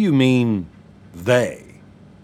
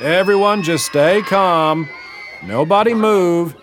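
slow, raised, higher commands; commanding calm; 7 semitones higher, slower than usual. A narrator's reading. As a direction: Marlon ordering the panicking children, slow and raised. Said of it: "Marlon's voice again".

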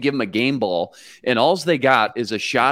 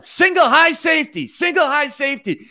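mock yell; 12 semitones higher than usual. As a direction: shout the coverage call like on the sideline. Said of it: "and so he's yelling".

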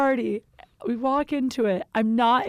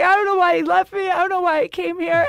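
high, loud imitation of a tearful wail; mock drunk wailing, pitched up and rushed; drunken crying; 8 semitones higher, 4 dB louder, faster than usual. Retelling being left at the party, mock drunken crying. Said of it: "I started crying 'cause I was so drunk".

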